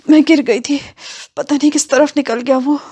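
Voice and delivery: injured, high and plaintive; groaning in pain. A narrator's reading. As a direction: moan the words out as if just fallen. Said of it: "عشرت کراہتے ہوئے بولی".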